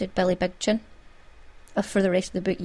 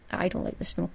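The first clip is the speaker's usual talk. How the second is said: ordinary delivery, nothing marked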